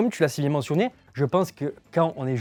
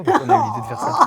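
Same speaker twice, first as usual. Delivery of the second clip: laughing; 12 semitones higher, 5 dB louder, faster than usual. Real, not acted. Disgusted, laughing at the soda.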